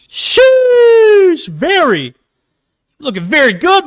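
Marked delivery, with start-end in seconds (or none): 0.1-2.1 very high, loud drawn-out sheesh; hyped sheesh. Real, not acted. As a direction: stretch it out in amazement. Reacting to a big hit.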